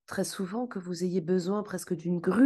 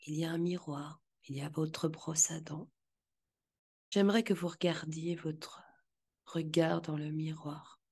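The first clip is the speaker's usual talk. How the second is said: guided visualization. Acted.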